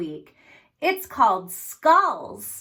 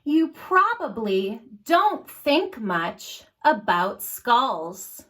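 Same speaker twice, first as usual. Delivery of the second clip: slow, gentle read-aloud pace; reading to children; slower than usual. Acted. Librarian reading Skulls to children, slow read-aloud. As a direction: read it slowly to little ones at story time. Reads the picture book aloud.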